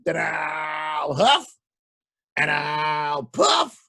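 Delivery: slow, stretched huff and puff; drawn-out wolf threat. Acted. Wolf threatening to blow the house down. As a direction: stretch each word like a menacing wolf.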